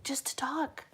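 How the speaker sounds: soft whisper ending. Real, not acted. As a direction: drop to a whisper. Trailing into whisper.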